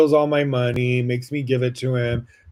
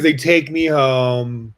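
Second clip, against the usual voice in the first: usual speaking voice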